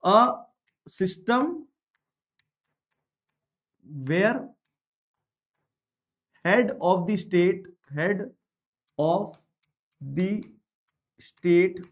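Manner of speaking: word by word with long pauses; measured classroom dictation, each word separate; slow dictation. Real, not acted. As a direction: dictate slowly, leaving time to write. Dictating a definition to students.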